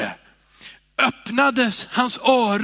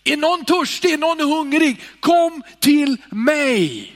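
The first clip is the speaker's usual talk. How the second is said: high, loud proclaiming call; 8 semitones higher, 5 dB louder than usual. Acted. Jesus calling to the people in the square, loud shout. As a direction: call out to the crowd. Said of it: "ropade till folket".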